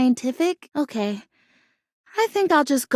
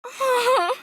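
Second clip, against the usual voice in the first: high little giggle; a nervous hopeful laugh; quick giddy giggle; shy giggle; 7 semitones higher than usual. Acted. Asks if you see difference.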